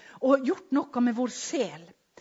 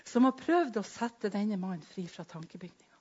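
ordinary delivery

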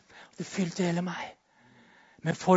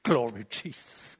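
laughing praise; 6 dB quieter, slower than usual. Real, not acted.

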